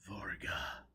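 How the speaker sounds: soft, breathless whispered name; mumbled. A narrator's reading. Dazed Foyle mumbling a ship's name to Joseph. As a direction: barely get the word out, half conscious. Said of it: "Foyle mumbled".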